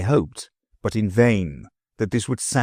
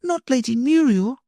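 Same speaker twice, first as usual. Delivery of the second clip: put-on much higher sweet girl voice; a little girl hurrying off; high child's voice; little girl Sylvie. Sylvie pleading.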